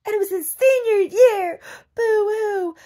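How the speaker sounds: high, loud, whiny fake sob; mock tearful team mom wailing; mock crying mother. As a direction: ham up her sobbing over her son.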